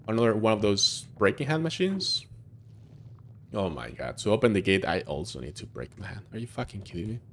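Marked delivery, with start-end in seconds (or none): none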